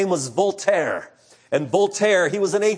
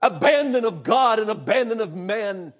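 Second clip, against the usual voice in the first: despairing cry; 5 semitones higher than usual. Retold or imitated. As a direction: cry out abandoned and terrified. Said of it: "He said".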